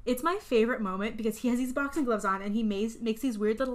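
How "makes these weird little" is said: ordinary talk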